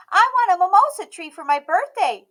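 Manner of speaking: put-on high, bright little girl voice, loud and proud; a stubborn little girl declaring herself; Emmy's child voice. A narrator's reading. Emmy speaking.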